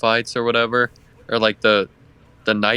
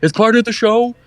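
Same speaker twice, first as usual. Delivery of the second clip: higher, pitched-up announcement; calling out to a crowd; 8 semitones higher, 3 dB louder than usual. Pretend. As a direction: reassure onlookers it's all staged. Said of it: "just be like".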